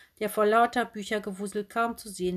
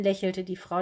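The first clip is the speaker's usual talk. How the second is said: plain reading voice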